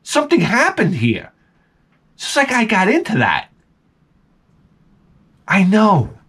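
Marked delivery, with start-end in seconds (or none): 0.0-1.3 high, delighted surprise; amazed. Retold or imitated. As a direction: marvel at the happy plants. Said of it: "I said".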